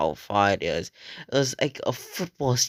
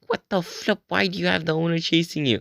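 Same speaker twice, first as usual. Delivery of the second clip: startled disbelief; 7 semitones higher, faster than usual. Retold or imitated. Confused reaction to friend fleeing.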